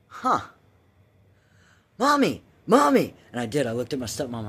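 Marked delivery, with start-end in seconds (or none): 0.1-3.1 put-on high, loud little-girl call; childlike call. Bored child calling her stepmom, high loud. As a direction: call for mom like a small kid. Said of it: "staring at this going".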